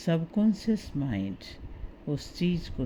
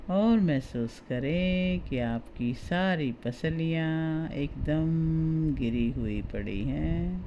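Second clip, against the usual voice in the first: guided meditation. Acted. Guide talks listener through relaxing the body for sleep, guided meditation. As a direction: ease the listener towards sleep, stretching key words.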